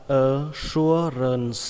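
"assurance" said slowly and clearly; slow model word. Shown as a demonstration. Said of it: "các bạn đọc là".

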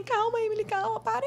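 high-pitched imitation of worried colleagues; pleading colleagues. The girls trying to stop the model arguing, high mock pleading. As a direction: mimic friends urging restraint, pitched well up. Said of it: "as meninas tudo assim".

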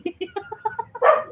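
high, climbing peal of laughter; laughing. Real, not acted. Laughs after reading viewer comment. (tawang pataas nang pataas)

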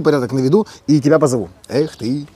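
normal talking voice

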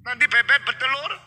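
loud, high, emphatic reassurance; acted indignant, protective father; father waving away fears. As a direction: brush the worry aside at full voice. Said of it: "Papa bilang".

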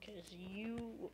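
ordinary delivery

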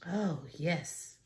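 usual speaking voice

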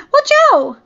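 high, loud warning shout. A narrator's reading. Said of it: "Jade shouted".

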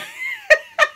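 shrieking laugh; high squealing laugh. Real, not acted. Vlogger cracking up over the snowman story, high squealing laugh.

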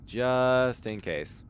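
JUST held long, the rest slow; drawn out. Real, not acted. Repeats the phrase drawn out.

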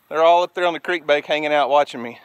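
ordinary talk

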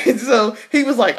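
ordinary talk, nothing marked

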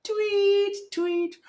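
high chirpy tweet, like a little bird; birdie tweet. Shown as a demonstration. Teacher showing kids a bird's tweet, high chirp. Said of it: "Can you sing tweet?".

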